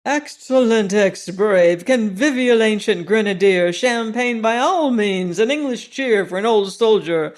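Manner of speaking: loud, high cheering toast. Acted. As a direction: raise the glass and cheer with abandon. Gambler toasts soldier, cheering.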